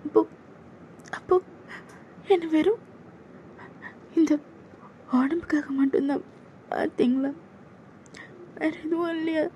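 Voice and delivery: put-on timid young woman begging him to stop; scared junior girl. Junior girl begging her senior to back off, high and timid.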